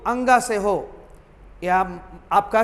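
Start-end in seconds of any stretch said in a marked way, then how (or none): none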